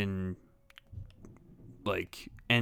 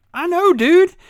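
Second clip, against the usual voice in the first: high, loud exasperated I know; knowing exclamation; 13 dB louder, slower than usual. Retold or imitated. To a stranger in the post office, high loud exasperation. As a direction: squeal it out, way up and loud. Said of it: "and I'm like".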